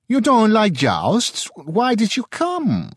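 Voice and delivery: high, slow puzzled question; a merchant who honestly can't see the point; lightly voiced Italian trader; curious merchant. A narrator's reading. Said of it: "asked an Italian merchant".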